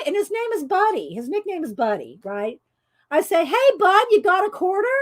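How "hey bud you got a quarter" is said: high, loud call to Bud; loud call over noise. Retold or imitated. Asking husband for a quarter over loud games. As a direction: call out over the arcade din. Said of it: "talking loud over the game".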